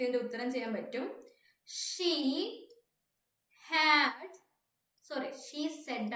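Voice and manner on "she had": slow dictation. Real, not acted. Teacher writing out the next question, slow dictation. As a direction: dictate word by word.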